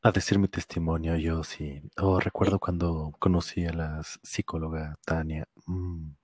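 low, soft seductive testimony; sultry narrator. Pretend. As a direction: hotline voice, low and hushed. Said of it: "ni que fuera, este, línea de hotline".

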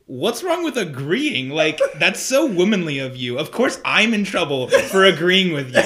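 hammed-up indignation; mock indignant protest. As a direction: protest as if unjustly accused, half laughing. Retold text battle, playful protest.

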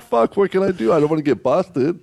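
mock panic. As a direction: replay the panic over the body half laughing. Retold panic at dead body.

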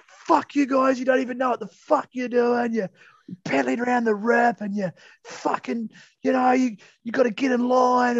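high-pitched mock rant; losing it. Surfer telling off the other guys, mock furious. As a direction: imitate a surfer losing his temper, voice pitched right up. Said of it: "Zane just sort of loses it".

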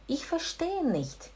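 quiet, slow, careful German; polite soft German. Shown as a demonstration. Teacher models a polite German phrase, softly. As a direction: say it softly and slowly. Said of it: "Eu não entendo".

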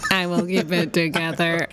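stiff, even robot voice. Pretend. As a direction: talk like a robot, each word level. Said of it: "To your robot voice".

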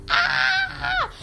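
imitating her friend's shriek at the gunshot; mock scream. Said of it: "she was like".

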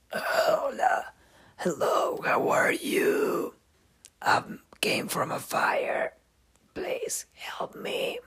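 hoarse, whispered patient voice; breathy rasp. Shown as a demonstration. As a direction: rasp it out weakly like a burn victim. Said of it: "That's how the patient's gonna talk to you".

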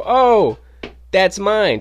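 high, loud mock-surprised claim; feigned innocence. Claiming his dropped cards before the manager, loud feigned surprise. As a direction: act as if it's an innocent accident. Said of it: "trying to act like I dropped".

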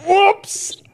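squeaky whoops. Acted. Squeaky oops after confession.

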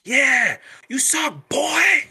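"boy" stretched high and loud, mocking; demeaning emphasis on boy. Shown as a demonstration. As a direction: sneer "you suck, boy" with a loud, high "boy". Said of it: "if you're putting an emphasis on the word boy".

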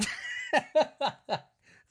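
slow, laughing through the words; laughing it off as nonsense. Real, not acted. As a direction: scoff it out between laughs. Laughing at the silly article.

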